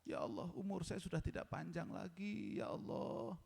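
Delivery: soft plea trailing into breath; mock-weeping prayer; imitated tearful prayer. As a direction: plead softly to God as if near tears. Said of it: "kita sudah nangis".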